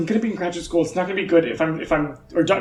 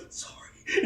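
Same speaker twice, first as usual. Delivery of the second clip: whispered sorry; 10 dB quieter than usual. Acted. Dogma whispering sorry while shutting the steel doors. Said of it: "he just goes".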